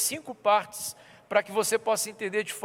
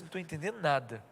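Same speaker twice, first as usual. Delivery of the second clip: softer, fast muttered aside; under the breath; 4 semitones lower, 9 dB quieter, faster than usual. Retold or imitated. Pastor holding back his reaction, softer muttered aside. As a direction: mutter it almost to yourself. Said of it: "quase que eu falei assim".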